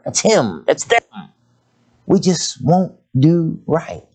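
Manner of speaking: high, punchy preaching lines; fiery sermon call. Acted. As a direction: drive each point home to the church. Preaching climax.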